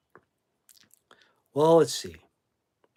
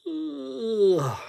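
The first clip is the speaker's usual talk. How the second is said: disgusted groan; 10 semitones higher than usual. Real, not acted. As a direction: groan like the band bores you. Groan at band name.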